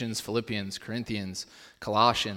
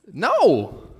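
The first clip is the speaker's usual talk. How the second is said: emphatic no; 8 semitones higher, 7 dB louder than usual. Acted. Emphatic answer after rhetorical question.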